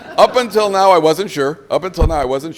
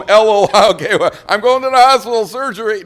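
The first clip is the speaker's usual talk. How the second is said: loud, high-pitched comic declaration; hammed-up husband calling out his plan; mock announcement; 9 semitones higher, 11 dB louder than usual. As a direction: announce it with over-the-top eagerness. Mock announcement by eager husband going home.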